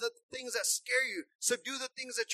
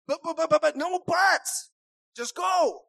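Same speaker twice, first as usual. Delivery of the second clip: louder, cutting off every excuse; firm loud push; 4 semitones higher, 7 dB louder than usual. Acted. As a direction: shove him forward: no buts. God urging reluctant Moses.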